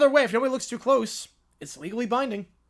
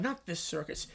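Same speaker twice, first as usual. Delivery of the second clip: ordinary delivery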